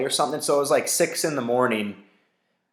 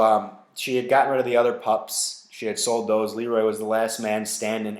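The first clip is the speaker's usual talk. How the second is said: usual speaking voice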